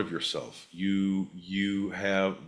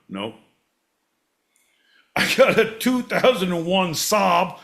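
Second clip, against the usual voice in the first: angry shouting; 7 semitones higher, 8 dB louder than usual. Retold or imitated. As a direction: bellow each car like a war cry. Imagined angry self, shouting.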